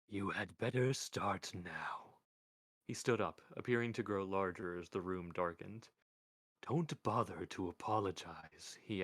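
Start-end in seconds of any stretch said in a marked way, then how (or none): 0.1-2.2 slow, measured voice of Death; Death's voice. Acted. Death speaks, slow and low. 6.6-8.8 slow, measured voice of Death; Death's voice. Acted.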